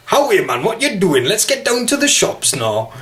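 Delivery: high, sing-song mock Newcastle accent; attempted Geordie. Shown as a demonstration. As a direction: put on a hammed-up Geordie voice.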